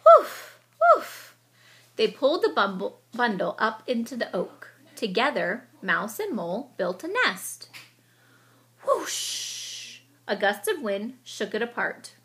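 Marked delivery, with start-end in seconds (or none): 0.0-1.4 high loud barking woof; dog bark. Acted. Dog barking at Mouse and Mole, high loud barks. 8.8-10.0 wind whoosh. Acted. Wind gust sound.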